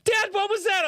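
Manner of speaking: put-on very high child's wail; squeaky, mock-distraught little voice; imitated upset daughter. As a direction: whine it like a distressed kid. Imitates upset daughter after scolding.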